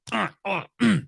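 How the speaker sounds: high choked catches of breath, imitated; pre-cry gasping. Parent imitating a hurt kid filling up to cry, choked gasps. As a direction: mimic a kid gulping air before screaming. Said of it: "you hear, like, a".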